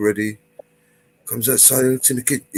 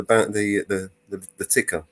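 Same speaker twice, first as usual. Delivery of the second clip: ordinary delivery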